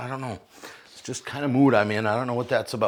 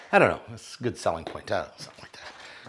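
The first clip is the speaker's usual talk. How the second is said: ordinary delivery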